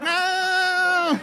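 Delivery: very high, loud wail; long drawn-out theatrical scream; mock horrified cry. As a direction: imitate a wife wailing in dismay. Imagined wife cries out in horror.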